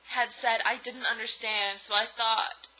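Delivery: usual speaking voice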